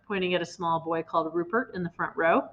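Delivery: plain reading voice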